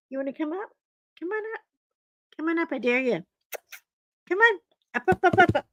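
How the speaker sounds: high coaxing pet voice; coaxing the dog. Real, not acted. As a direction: lift the pitch to lure the dog up onto the table. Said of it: "coaxing dog up".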